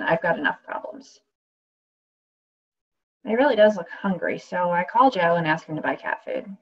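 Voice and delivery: wry, lively first-person performance, full of asides; radio play monologue. Acted. Actress reading a radio play aloud, wry and lively. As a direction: play the injured woman talking straight to the audience.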